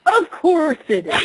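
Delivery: slow dry sarcasm; sarcastic. Acted. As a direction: drag it out, unimpressed.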